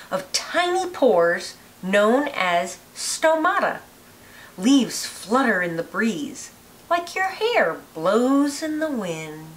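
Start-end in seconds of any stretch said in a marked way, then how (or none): none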